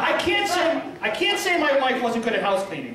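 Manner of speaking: ordinary delivery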